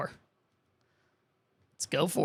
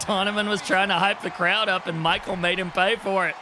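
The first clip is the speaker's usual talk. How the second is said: lively play-by-play call; wrestling commentator; 3 semitones higher than usual. Acted. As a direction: call the match like a TV announcer. Calls the wrestling match.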